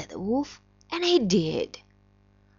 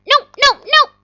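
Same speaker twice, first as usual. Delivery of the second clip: little pig voice; 13 dB louder than usual. Acted. Three little pigs refuse.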